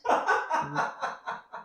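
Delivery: high wheezing laugh; laughing fit. Real, not acted. Host cracking up at their own silly accent, wheezing laughter. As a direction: crack up breathlessly.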